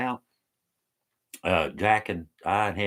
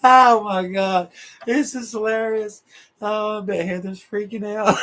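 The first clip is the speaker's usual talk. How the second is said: laughing; 10 semitones higher, 5 dB louder than usual. Real, not acted. Laughing fit on a livestream.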